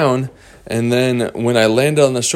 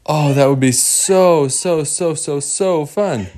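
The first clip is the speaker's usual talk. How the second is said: higher-pitched, repeated so's stretched at the end; acted delight; 5 semitones higher than usual. Cage girl accepting the new home, delighted. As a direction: gush with delight, slowing into the last words.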